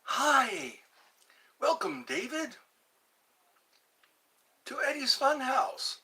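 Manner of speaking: high, bright host welcome; game show host. Acted. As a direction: welcome him like a funhouse host. Host greeting viewer by name.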